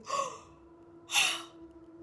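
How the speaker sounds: sharp high gasp of shock; startled gasp at a snake. Retold or imitated. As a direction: suck in air in horror.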